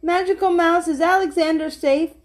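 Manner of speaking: put-on high, anxious storybook old lady; lightly acted trembling. A narrator's reading. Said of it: "The old lady's voice trembled".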